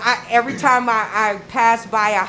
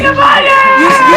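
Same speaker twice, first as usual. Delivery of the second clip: excited yelp; 14 semitones higher, 9 dB louder than usual. Real, not acted. Excited teasing.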